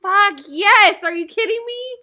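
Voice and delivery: high, loud whoop of delight; thrilled outburst. Real, not acted. Player delighted with the movie just assigned, thrilled outburst. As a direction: burst out, overjoyed.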